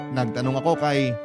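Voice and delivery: ordinary talk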